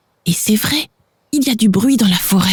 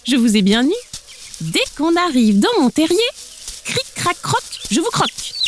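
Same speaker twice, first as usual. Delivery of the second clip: lightly voiced sly weasel, higher; a sly weasel gloating over her catch; mild villain glee, softer at the croc; 7 semitones higher, 3 dB quieter than usual. Weasel gloats over caught chicks.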